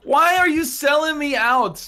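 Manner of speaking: ordinary talk, nothing marked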